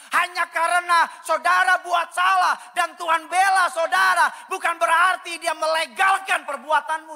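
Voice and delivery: loud, high, forceful sermon delivery; full-force preaching. Real, not acted. Pastor warning that God's defence is no licence, full-force preaching. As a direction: thunder it out to the congregation.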